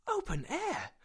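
very high, incredulous echo; astonished. A narrator's reading. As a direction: repeat it in amazement. Nephew astonished at open air.

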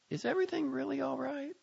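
high, cautious female voice; a puzzled partner; wary wife on phone. A narrator's reading. Sophie asks on phone.

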